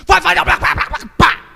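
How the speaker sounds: high, loud rapid-fire barking; spitting firm command. Shown as a demonstration. Parent making the child understand, forceful barking. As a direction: bark it out till you spit. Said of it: "até cuspir na cara da criança".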